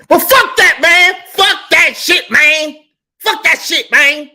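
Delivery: loud high furious shout; explode, yelling. Real, not acted. Explodes rejecting proper speech.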